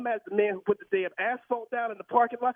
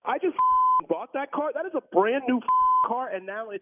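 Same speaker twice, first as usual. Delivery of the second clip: furious; 5 semitones higher than usual. Real, not acted. Car owner yelling at the manager over his missing car, furious. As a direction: blow up at the valet manager.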